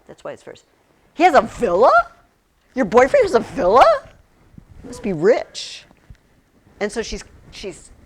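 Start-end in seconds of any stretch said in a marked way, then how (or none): 1.1-5.8 voicing Doripa, lightly amazed, has rising; a playful gasp of disbelief; mock surprise. Teacher playing Doripa impressed by the boyfriend's villa, mock surprise. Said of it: "she's emphasizing the word villa".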